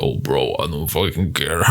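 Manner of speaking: low, soft, mock tough-guy voice; macho alpha bro. Movie alpha man shrugging off his feelings, low and soft. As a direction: drop it low like a mumbling macho dude. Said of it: "alpha man is like".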